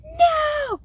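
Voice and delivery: squeaky high no; high child protest. Retold or imitated. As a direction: mimic the kid's sharp no. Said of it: "I'm just".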